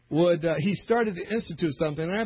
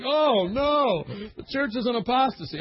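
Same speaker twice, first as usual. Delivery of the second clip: mock dismay; 8 semitones higher, 3 dB louder than usual. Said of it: "They're like".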